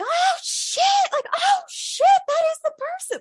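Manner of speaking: a high, excited oh shit of disbelief; freaking out. Retold or imitated. American friend sees the dead dad's photo, high excited freakout. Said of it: "not, like, scared screaming, but like".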